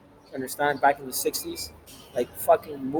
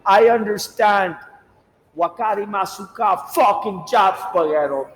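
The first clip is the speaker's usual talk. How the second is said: mock Japanese accent; 3 semitones higher than usual. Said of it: "he's gonna be like".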